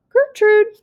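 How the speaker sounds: high, loud squawking parrot; parrot scream. Acted. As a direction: screech it like a parrot. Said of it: "the parrot screamed again".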